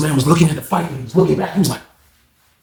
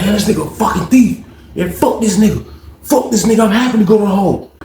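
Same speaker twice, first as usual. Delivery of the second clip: defiant yell; 8 semitones higher than usual. Retold or imitated. Roommate yells as police grab him.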